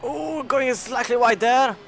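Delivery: excited commentary. Real, not acted. Excited race commentary moment.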